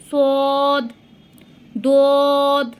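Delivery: সদ thick and hard, lips rounded; heavy Arabic sad. Shown as a demonstration. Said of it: "একটু মোটা এবং একটু শক্ত করে".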